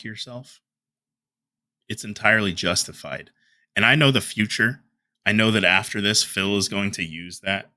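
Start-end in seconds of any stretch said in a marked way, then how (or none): none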